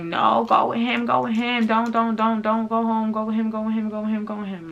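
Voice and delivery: high-pitched, pleading repetition; imitating a pushy friend, high and urgent; insistent urging. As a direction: push her to go, over and over. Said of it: "She's convincing me".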